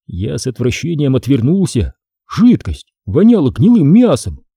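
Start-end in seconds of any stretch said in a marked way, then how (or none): none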